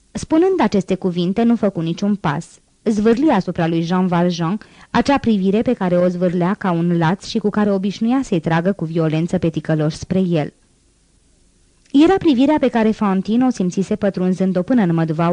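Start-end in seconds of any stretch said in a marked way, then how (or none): none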